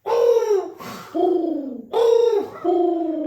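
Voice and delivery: very high, loud hooting bird call; bird noises. Retold or imitated. Drunk Josh hiding in a tree, hooting like a bird. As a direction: hoot like a bird from the treetop. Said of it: "Him doing bird noises".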